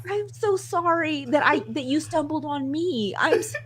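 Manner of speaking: high, drawn-out comic apology; hammed-up high-pitched regret breaking into laughter; mock apology. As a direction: plead sorry in a high voice, about to laugh.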